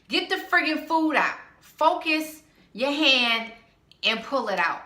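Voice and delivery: loud, high exasperated command; exasperated order. Acted. Exasperated order to noisy bag rummager.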